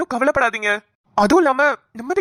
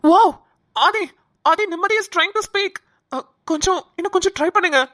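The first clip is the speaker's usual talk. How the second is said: excited urging; 7 semitones higher than usual. Acted. Doctor sees patient stirring.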